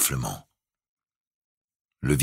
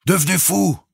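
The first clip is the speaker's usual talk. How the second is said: high, loud outburst; sudden shout; 6 dB louder than usual. A narrator's reading. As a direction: burst out suddenly down the tube. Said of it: "reprit soudain la voix du capitaine". (cri soudain)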